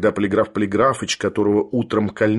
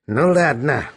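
mildly raised, spiteful concession; 3 semitones higher, slower than usual. A narrator's reading. Sharikov surrenders the ring, spiteful. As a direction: give in with veiled spite. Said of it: "вдруг злобно сказал он".

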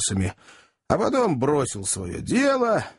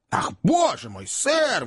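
exclaiming; 8 semitones higher than usual. A narrator's reading. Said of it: "воскликнула вдова".

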